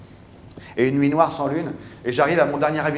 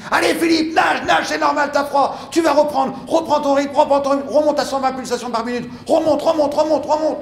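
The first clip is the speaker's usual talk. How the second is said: much higher, louder shouting; crew shouting; 13 semitones higher, 6 dB louder than usual. Retold or imitated. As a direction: yell him back into rhythm. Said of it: "j'entends mon équipe qui crie".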